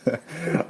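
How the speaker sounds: short high giggle; quick high laugh burst. Real, not acted. Guest laughs at remark.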